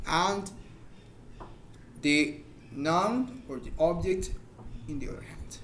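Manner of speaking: usual speaking voice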